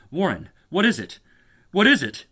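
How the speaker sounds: high, loud, panicked repeat; terrified. Acted. Carter shouting into the phone, terrified. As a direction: shout the question down in terror. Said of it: "Terrified, I continued to repeat".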